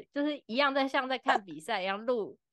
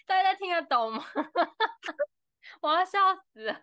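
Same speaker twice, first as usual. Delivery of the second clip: high, louder, laughing through the words; dying of laughter, real; 9 semitones higher, 4 dB louder than usual. Real, not acted. Host cracking up at the library joke, laughing.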